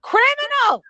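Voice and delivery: high, loud outburst; emphatic exclamation. Real, not acted. Exclaims, echoing co-host's word.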